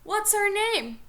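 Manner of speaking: imitated yell. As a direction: yell it like an angry girlfriend. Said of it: "Cindy yells".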